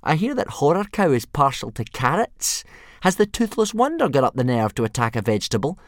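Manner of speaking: high, loud mockery; bully taunting Hiccup's friends. A narrator's reading. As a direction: rub it in with fake concern. Snotlout taunting.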